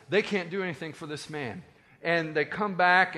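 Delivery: ordinary talk, nothing marked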